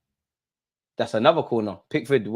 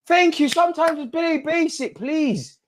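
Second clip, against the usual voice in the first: sarcastic plea; slower than usual. Real, not acted.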